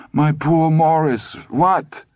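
high, drawn-out lament; pitying wife. A narrator's reading. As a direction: wail with pity, then ask anxiously. Weeping wife pities injured husband.

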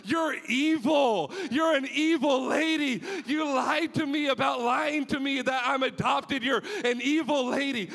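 high, loud indignant shouting; angry yell. Retold or imitated. Son yelling at his joking mother, high indignant shout. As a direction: yell it at her, outraged.

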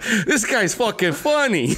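high, slow words through laughter; laughing. Retold or imitated. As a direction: laugh while you say it. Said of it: "he started laughing so hard".

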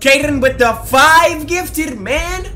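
high, loud, drawn-out hype; hyped thanks. Real, not acted. Streamer thanking a viewer for five gifted, hyped call. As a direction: stretch the shout-out with excitement.

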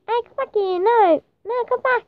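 high, urgent little calls; calling a pet back. Real, not acted. Calling a runaway pet rabbit.

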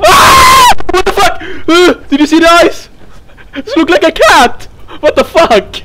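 high, loud, alarmed exclamations; shriek, then shout in real alarm; frightened high-pitched outburst; startled screaming. Real, not acted. Startled by eyes in the dark.

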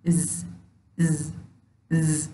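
از said as a short buzzing az; isolated z sound. Shown as a demonstration. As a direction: voice از with a light buzz. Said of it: "چنین صدا دارد".

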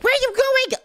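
high, squealed question; panicked call. Acted. Caught spy watches kid flee, panicked high squeal. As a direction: cry out as your ally deserts.

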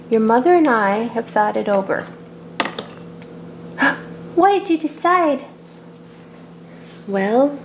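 normal talking voice

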